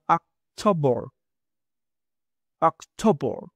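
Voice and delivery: "October" with an open first vowel; open o demo. Shown as a demonstration. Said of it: "prácticamente es A, normal". ("October" con A normal)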